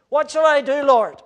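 loud, high cry of a desperate man; ringing preacher's cry; impassioned cry. Acted. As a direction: cry the question out to heaven. Preacher cries out Saul's question.

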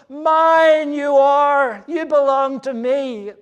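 high, loud, slow Mine you are; emphatic declaration. Acted. God telling his people they belong, loud and slow. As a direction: proclaim it as God's own triumphant claim. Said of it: "the language is so emphatic".

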